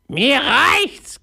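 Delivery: very high parrot screech; squawking parrot. A narrator's reading. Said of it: "krächzte er". (krächzender Papagei, sehr hoch)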